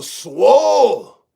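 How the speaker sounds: drawn-out, hammed-up shout of bragging; mock-proud swooping shout; mock boast. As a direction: stretch the word into a big playful holler. Mock boasting about his muscles.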